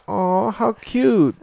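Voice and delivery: high, slow mock-sweet aw how cute; mock cooing. Friend acting Arya's reaction to the proposal, high slow mock coo. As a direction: coo it like a pitying girl. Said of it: "It's more like a".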